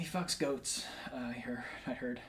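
very soft, trailing into a whisper; furtive near-whisper. Real, not acted. Co-host sneaks in a rumour about a friend, half whispered. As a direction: mutter it as if avoiding the mic. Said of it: "you kinda whispered like you didn't want it to be on the mic".

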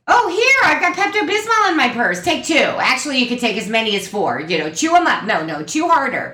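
high, bright-eyed mock mom; be the mother digging in her purse; imitating mom. Daughter voicing mom handing out Pepto, high mock voice. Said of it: "so my mom's like".

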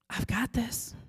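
same words again, now an unvoiced whisper; fading whisper. God's promise repeating in her head, whispered. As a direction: let the echo die to a breath. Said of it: "But I kept hearing it".

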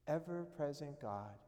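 hushed prayer that keeps softening toward the end. Acted. Closing prayer.

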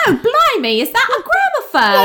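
high, hammed-up Cockney exclamation; mock Cockney. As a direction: play a startled old-time Brit. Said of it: "these accents are so".

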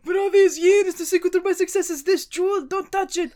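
high mock cartoon voice warning. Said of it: "that Psychic Pebbles animation where it's like".

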